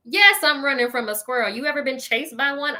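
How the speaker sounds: usual speaking voice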